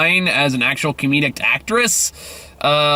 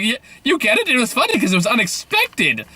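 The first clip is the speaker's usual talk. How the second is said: high-pitched, patronizing parody voice; hammed-up mockery of a condescending film; mock over-explaining; 8 semitones higher than usual. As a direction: spell out the joke in a silly high voice. Mocking trailer over-explaining its joke.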